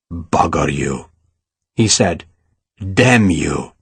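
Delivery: low, menacing curses; low angry cursing. A narrator's reading. Ferragus swears at Sarah.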